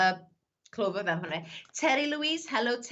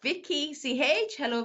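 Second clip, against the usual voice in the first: usual speaking voice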